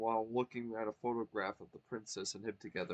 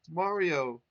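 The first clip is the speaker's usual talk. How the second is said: louder call; cried out; 6 semitones higher, 8 dB louder than usual. Acted. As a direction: call his name out. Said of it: "he cried".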